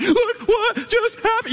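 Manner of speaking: very high, drawn-out mock wail; can't-talk crying. Husband showing his wife what happened, imitated slow, squealing crying. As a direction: slowly squeak it out through exaggerated sobs. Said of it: "I can't even talk type crying".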